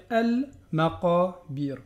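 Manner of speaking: Al-Maqabir with a clear l; slow Al-Maqabir, l sounded; sounded lam. Shown as a demonstration. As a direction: pronounce the l in Al-Maqabir. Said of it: "là je vais le prononcer".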